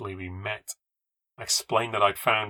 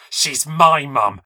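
slow, forceful, higher-pitched anger; furious hiss; 5 semitones higher, 11 dB louder, much slower than usual. Acted. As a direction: hiss it slowly through gritted teeth. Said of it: "she hissed through gritted teeth".

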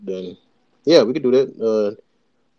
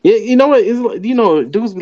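excited; 10 semitones higher, 5 dB louder than usual. Real, not acted. Worked up reacting to a complaint.

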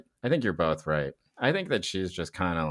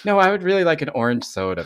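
high casual voice; 8 semitones higher, 6 dB louder than usual. Retold or imitated. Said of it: "the rest of the time she's like".

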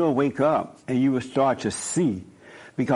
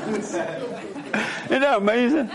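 laughing; 7 semitones higher than usual. Real, not acted. Telling listeners about the guard, laughing through it. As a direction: chuckle it out, amused and amazed.

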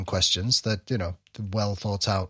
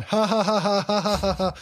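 high, even string of forced ha-has; copycat fake laugh; 9 semitones higher than usual. Dad imitating the second kid joining in, fake laugh. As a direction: mimic a child copying a fake laugh. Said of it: "the other one starts laughing".

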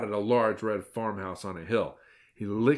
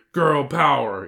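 loud yell, moderately raised; yelled rallying cry; 5 semitones higher, 8 dB louder, slower than usual. A narrator's reading. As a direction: yell the slogan, short and punchy.

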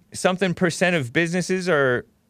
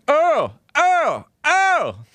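high, sing-song calling of a name; high mock call reading out a chat comment; robot chat voice; 12 semitones higher, much slower than usual. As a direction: read it like a squeaky cartoon voice. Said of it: "Robot Moose says".

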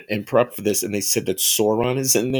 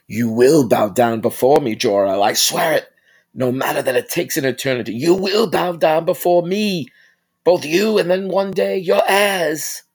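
higher, louder vengeful villain threat; imitating General Zod; 6 semitones higher, 5 dB louder than usual. Vlogger imitating the villain vowing revenge on Jor-El, loud and furious. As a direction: swear revenge, rising to a roar. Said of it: "I think I nailed that".

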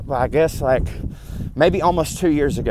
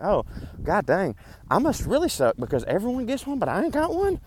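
whiny high self-pity; 7 semitones higher, 5 dB quieter than usual. Retold or imitated. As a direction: voice climbing higher and higher. Retold self-pity, voice climbing high.

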